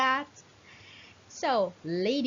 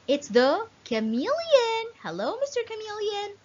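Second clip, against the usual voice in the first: high, excited gasp and greeting; delighted discovery; 10 semitones higher than usual. Acted. Teacher to children, an excited high greeting to the chameleon. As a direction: thrill the kids with the find.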